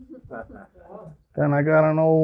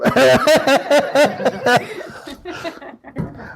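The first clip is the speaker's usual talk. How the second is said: a burst of high, real laughter; delighted high laugh; 11 semitones higher, 4 dB louder than usual. Real, not acted. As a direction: laugh out loud at the joke. Keeps laughing at the joke.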